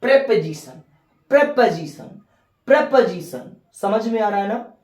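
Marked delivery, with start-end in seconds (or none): 0.0-3.6 प्रेपोजिशन with a short e: prep-e-zishan, repeated; correct pronunciation. Shown as a demonstration. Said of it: "प्रीपोजिशन नहीं होता है". (प्रेपोजिशन का सही उच्चारण, बार-बार)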